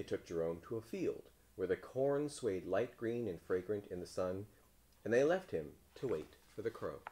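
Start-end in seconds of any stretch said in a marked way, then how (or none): none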